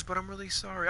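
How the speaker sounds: mocking Bonnie's apology accent on sorry; mock simpering apology. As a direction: drop to a soft, fake-contrite sorry. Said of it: "It's like".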